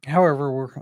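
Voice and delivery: ordinary delivery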